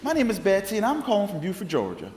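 squealing, high and loud Southern greeting; high Southern lady. Retold or imitated. Betsy repeats herself, bubbly caller.

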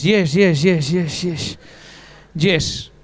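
low, nervous run of yes. Retold or imitated. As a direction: stammer yes while sweating. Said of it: "ya con eso sudas".

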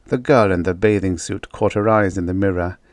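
ordinary delivery

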